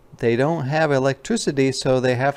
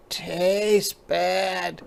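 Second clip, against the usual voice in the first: small cub voice, higher, whiny; sulky little cub; 8 semitones higher than usual. Acted. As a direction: grumble like a sick little bear. Sam voiced, refusing medicine.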